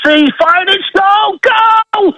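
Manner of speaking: commentator's climbing yell; shouted goal call. Shown as a demonstration. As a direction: scream it as the ball hits the net. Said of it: "soccer goal announcement".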